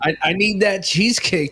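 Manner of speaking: high, slow pretend junkie plea; joking, drawn-out desperation; mock addict craving. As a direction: plead like a hammed-up addict needing a fix. Acts addict craving cheesecake, others laugh.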